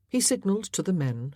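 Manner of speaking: plain reading voice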